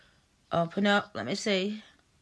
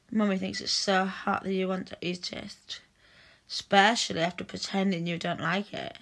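ordinary talk

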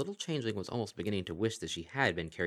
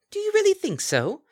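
much higher and louder, gleeful; delighted Kelpie; 11 dB louder than usual. Acted. The Kelpie to Bon Bon, thrilled and gushing. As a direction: squeal it with mock delight. Kelpie back to her own voice.

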